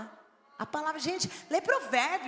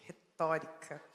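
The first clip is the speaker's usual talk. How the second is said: posh; 8 semitones lower, slower than usual. Real, not acted. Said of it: "Ó como eu sou chique".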